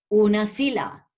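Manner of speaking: ordinary talk, nothing marked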